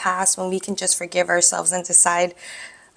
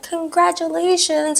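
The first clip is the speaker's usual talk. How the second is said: a high, slow, sing-song congratulations; cheerful doctor; 11 semitones higher, slower than usual. Retold or imitated. Doctor congratulates the confused patient, high and slow. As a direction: play the doctor's bright, drawn-out announcement. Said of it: "Doctor comes in a few minutes later".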